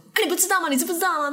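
high mock-repeating challenge; nagging accuser. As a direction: imitate the man harping on her. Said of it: "那个男的一直在那边说".

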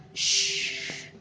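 a soft hushing whisper; shushing whisper. Acted. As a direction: hush them gently, barely voiced. Grandpa hushing the child.

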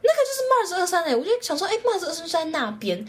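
high-pitched eager exclamation; excited discovery. Retold or imitated. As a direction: blurt it out thrilled at spotting him. Retold excited thought on spotting him. (兴奋地认出来)

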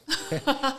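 high, quick laugh; burst of laughter. Real, not acted. Guest laughs about old snake-game phones, high laugh. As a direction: crack up briefly.

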